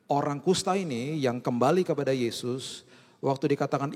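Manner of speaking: ordinary delivery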